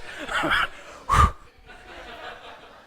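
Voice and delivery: amused high-pitched laughter at the audience. Real, not acted. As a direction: burst of high breathy laughter. Audience hasn't seen Moana.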